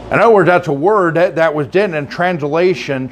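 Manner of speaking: ordinary talk, nothing marked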